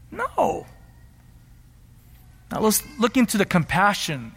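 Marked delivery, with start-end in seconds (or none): none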